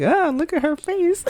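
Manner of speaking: high-pitched mock gasp of alarm; exaggerated, high onlooker's cry; shocked reaction. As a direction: squeal it in playful shock. Voicing onlookers shocked at her face.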